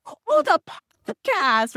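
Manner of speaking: loud, high-pitched exclamation; surprised realization. Retold or imitated. Host realizing a stranger knows the podcast, loudly surprised. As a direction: blurt it out as the penny drops. Retold moment of sudden recognition.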